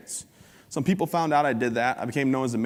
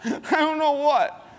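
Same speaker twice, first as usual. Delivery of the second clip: much higher, slow frightened squeak; squeaky scared teen; 4 dB louder, much slower than usual. Retold or imitated. Boyfriend answering the dad, high frightened squeak. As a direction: answer like a terrified kid.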